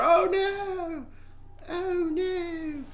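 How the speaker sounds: very high, drawn-out "oh no"; hammed-up cry of falling away; mock wail. Player pretends to fall down the trapdoor, mock wail.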